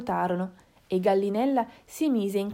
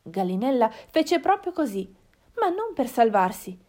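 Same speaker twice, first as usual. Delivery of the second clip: usual speaking voice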